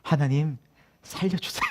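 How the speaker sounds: soft, hushed retold plea; earnest pleading prayer. Trembling recruit secretly praying to God, hushed earnest plea. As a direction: imitate praying desperately under your breath. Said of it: "진짜 간절히 기도했어요".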